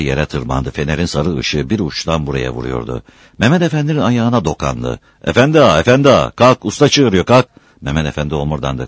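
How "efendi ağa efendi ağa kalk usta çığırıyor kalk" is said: high, loud urgent calls; calling to wake. Acted. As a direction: rouse a sleeper with insistent calls. Waking a sleeping man. (uyandırmak için seslenerek)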